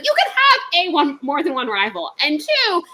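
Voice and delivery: high, loud outraged lecturing; exasperated rant. Retold or imitated. Arguing rivalry with the boss, loud high exasperation. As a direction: correct her in rising disbelief. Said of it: "And I'm like".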